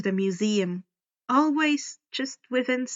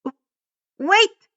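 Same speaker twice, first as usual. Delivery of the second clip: high, loud startled yelp; frightened boy's yelp; 9 semitones higher, 7 dB louder than usual. A narrator's reading. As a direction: blurt it out in a panic. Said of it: "Grover yelped".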